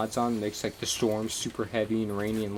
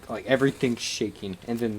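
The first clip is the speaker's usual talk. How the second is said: ordinary delivery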